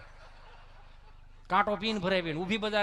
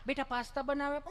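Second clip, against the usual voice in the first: doting parent; 5 semitones higher than usual. Retold or imitated. Parent offers another dish, acted.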